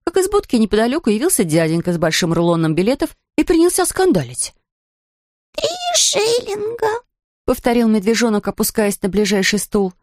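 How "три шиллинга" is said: high, slow dismayed bear; small high voice in dismay; Paddington voice. Acted. Bear repeats.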